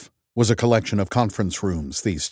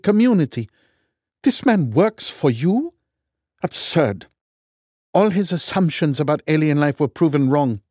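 higher, louder Norwegian contempt; scornful Norwegian; 9 semitones higher, 3 dB louder than usual. A narrator's reading. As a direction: sneer it in a Norwegian accent. Loken scornful.

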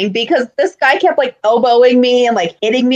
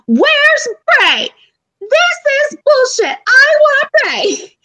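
high, slow wailing yell; yelling at top of lungs; 14 semitones higher, slower than usual. Retold or imitated. Annoying the rude neighbour, slow high wailing yell. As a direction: bellow it like a frustrated fan, drawn out. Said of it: "literally at the top of my lungs".

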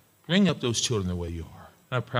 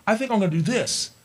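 rushed self-talk, high and eager; mock the hasty believer; impatient inner voice; 10 semitones higher, much faster than usual. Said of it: "don't just think".

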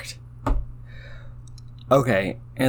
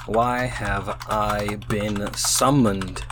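very slow, word by word; typing aloud; 3 semitones lower, much slower than usual. Real, not acted. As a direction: draw it out as you type. Saying words while typing them.

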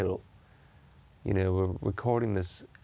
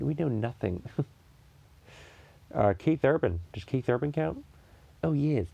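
ordinary delivery

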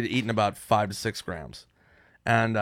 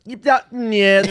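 high squeaky flustered yeps; nervous stammer; 11 semitones higher, 8 dB louder than usual. Retold or imitated. High teen stammering answers to his mom. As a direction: squeak out a guilty yes. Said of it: "I probably said".

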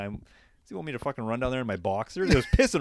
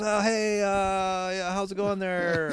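higher, slow mock stranger voice; lightly voiced friendly stranger, drawn out; imitating the stranger; 7 semitones higher, much slower than usual.

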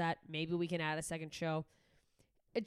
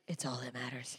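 lower, softer obsessive thought; low, soft inner voice; 8 semitones lower, 6 dB quieter than usual. Retold or imitated. Host voicing her obsessive inner voice, low and soft. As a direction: murmur it to yourself.

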